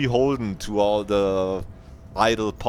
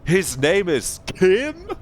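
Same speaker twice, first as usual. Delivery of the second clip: surprised; 11 semitones higher, 3 dB louder, much slower than usual. Retold or imitated. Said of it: "And I go".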